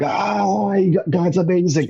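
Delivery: high, rapturous cry; ecstatic gushing. Retold or imitated. Overwhelmed teen gushing to friend.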